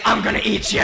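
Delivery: playful monster voice; mock monster threat. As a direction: menace a kid like a pretend monster. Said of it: "I'm running after her, saying".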